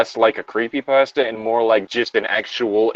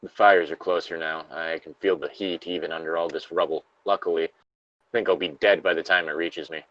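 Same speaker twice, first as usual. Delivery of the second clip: a lightly acted trapped survivor, reading steadily; reading a Fallout lore log aloud to a friend, somber; 5 semitones lower, 7 dB quieter than usual. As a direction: read the survivor's diary entry gravely. Reads the log, resigned.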